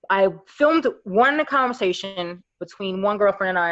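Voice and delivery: ordinary talk, nothing marked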